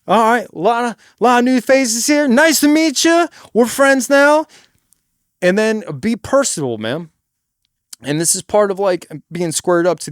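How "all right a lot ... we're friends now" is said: loud, high stage address; projected voice. Shown as a demonstration. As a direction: project to the back of the room. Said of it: "You gotta project your voice".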